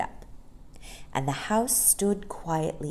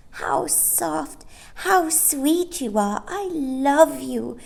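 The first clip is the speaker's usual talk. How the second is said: put-on high, slow adoring baby voice; baby Barbara; 10 semitones higher, slower than usual. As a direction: coo it like a baby at warm light. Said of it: "said Barbara".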